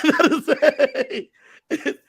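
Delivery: normal talking voice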